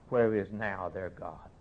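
ordinary talk

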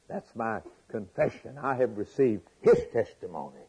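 ordinary delivery

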